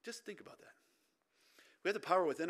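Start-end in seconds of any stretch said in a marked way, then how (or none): 0.0-0.7 whispered aside to the congregation; a hushed, unvoiced invitation to reflect. Real, not acted. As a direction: breathe it out so they ponder. Pause after surgery statistic.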